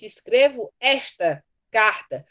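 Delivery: "esta" slowed and set apart; stress on ESTA. Shown as a demonstration. Teacher giving an example of letter writing, "esta" stressed. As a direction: lean slowly on "esta". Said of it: "você diz".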